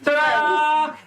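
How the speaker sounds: high, triumphant flourish; little showman's big reveal; boy performer. Acted. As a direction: show off the finished trick. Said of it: "And he said".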